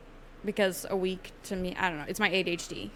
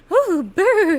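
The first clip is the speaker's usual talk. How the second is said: mock surprised coo; 11 semitones higher, 15 dB louder than usual. Mock reaction to cool weather.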